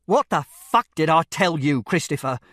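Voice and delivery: loud angry shout at his son. Acted. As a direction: blast it out, furious. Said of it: "This was much louder".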